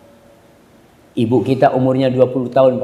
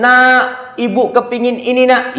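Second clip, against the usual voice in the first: mother calling; 9 semitones higher, slower than usual. Acted. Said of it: "Kadang-kala anak dipanggil".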